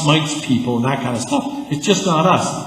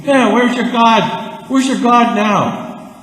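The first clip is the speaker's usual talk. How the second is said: slow, higher mocking jeer; jeering taunt; 7 semitones higher, 3 dB louder, much slower than usual. Preacher mocking Baal's prophets, slow jeering taunt. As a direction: drag it out like a schoolyard taunt.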